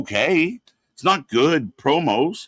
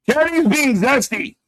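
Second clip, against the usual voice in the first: amused tease; 9 semitones higher than usual. Real, not acted. Amused tease after laughing.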